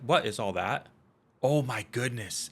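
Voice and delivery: startled. Real, not acted. Startled by sudden sight of dragons.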